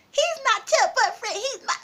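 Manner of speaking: high, mocking imitation of the rival; sassy back talk in a put-on high voice; running her mouth. Rival girl claims the boyfriend, imitated mouthing off. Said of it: "She still running her mouth".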